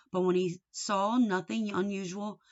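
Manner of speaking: ordinary delivery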